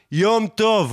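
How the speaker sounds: high-pitched re-enacted holler; mock calling out. Calling to the hipster across the crossing, mock yell. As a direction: call across a street, without full volume. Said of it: "אני צועק לו".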